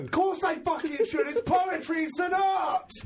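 loud, high mock rant; mock outrage. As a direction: fake fury about poetry. Mock outrage over poetry.

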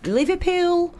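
drawn-out Liverpudlian put-on; Liverpudlian accent. Shown as a demonstration. Radio host showing off where she's headed, Liverpudlian accent. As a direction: say it in your best Scouse, stretched out. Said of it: "That was my best Liverpoolian accent".

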